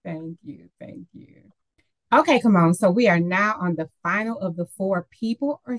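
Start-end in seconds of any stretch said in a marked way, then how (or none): 0.0-1.4 whispered thanks. Real, not acted. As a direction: breathe the thanks.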